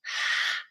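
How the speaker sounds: long breath pushed out through the mouth; audible exhale sigh. Shown as a demonstration. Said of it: "you can hear it".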